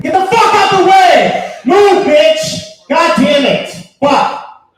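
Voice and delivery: high, very loud furious yelling; road-rage shout. Retold or imitated. Driver breaking off his prayer at traffic, road-rage shout. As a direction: explode at the car in your way. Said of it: "gets a little annoying sometimes".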